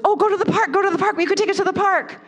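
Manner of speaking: put-on high, eager little kid voice; imitated four-year-old, high and insistent. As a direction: pitch the idea like an excited small child. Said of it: "The four-year-old says".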